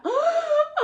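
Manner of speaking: sharp, high gasp imitating a sob; imitated sobbing gasp. Daughter acts out mom's shock with a gasp. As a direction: gasp like mom breaking down. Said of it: "she just fell out".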